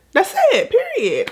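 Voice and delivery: high, laughing period; laughing sign-off. Real, not acted. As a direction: finish with a giggle.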